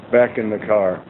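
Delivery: ordinary delivery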